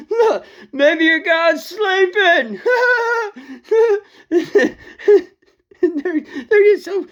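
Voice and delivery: high, slow, laughing jeer; laughing mockery. Elijah mocking the prophets, laughing loud and high. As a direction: jeer at them through laughter. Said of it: "mocking the Baal prophets".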